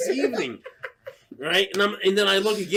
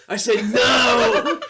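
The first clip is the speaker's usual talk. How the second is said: loud, drawn-out protest; emphatic insistence; 5 semitones higher, 5 dB louder, much slower than usual. Retold or imitated. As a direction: insist on it, stretching the last word. Insisting they refused the scammer.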